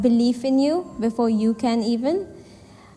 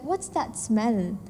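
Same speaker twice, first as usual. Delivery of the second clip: much softer and slow, a sly dig; snide quiet aside; 7 dB quieter, much slower than usual. Retold or imitated. Rival's snide aside, retold.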